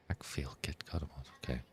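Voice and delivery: breathy secret whisper of Keck Feel Get Good emoji. Shown as a demonstration. Streamer whispers the emoji name to chat, softly. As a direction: hush it like a secret.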